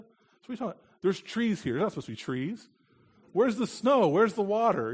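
put-on high, loud child's questions; puzzled child. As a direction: voice a baffled little girl. Daughter's puzzled objections voiced.

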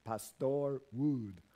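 put-on slow Filipino-accented "Pastor", stressed on TOR. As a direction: imitate Filipinos saying "Wood" with a long oo. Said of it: "accent the second syllable almost all the time".

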